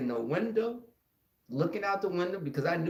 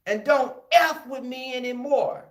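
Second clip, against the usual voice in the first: high, loud final threat; warning bully; 9 semitones higher, 5 dB louder than usual. Retold or imitated. Kid warning the beaten bully, loud and high. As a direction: lay down the law. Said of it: "And I told him".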